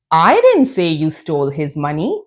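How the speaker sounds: I punched, MONEY lifted at the end; stress on I. Shown as a demonstration. Teacher showing how meaning changes, stress on "I". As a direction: hit the word "I" in the sentence. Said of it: "That means I didn't say".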